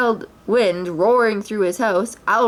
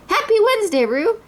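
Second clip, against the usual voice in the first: higher bright Pooh voice; Pooh greeting; 13 semitones higher than usual. A narrator's reading. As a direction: Pooh skids to a stop and greets.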